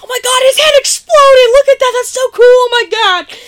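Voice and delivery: very high, loud gleeful squeal; mock thrilled shouting. Host voices Luke coming down the stairs, wildly thrilled shouting. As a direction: imitate a kid losing it with excitement.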